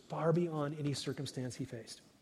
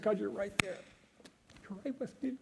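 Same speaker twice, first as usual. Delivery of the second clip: softer, higher, hushed assurance; quiet wonder; 7 semitones higher, 4 dB quieter than usual. Acted. Prophet realizing God is near, soft and hushed. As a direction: let it come out soft and amazed. Habakkuk's calm answer.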